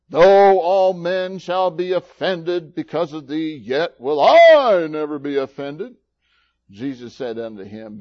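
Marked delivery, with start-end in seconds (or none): 0.1-5.9 exaggerated vow. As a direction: proclaim it with overblown pride. Quotes Peter's exaggerated vow.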